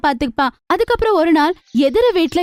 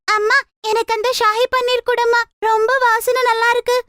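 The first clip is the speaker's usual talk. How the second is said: put-on higher childlike pleading voice; small child; 8 semitones higher than usual. Child asks mother for the paneer, high little-kid voice. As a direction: beg sweetly like a little kid.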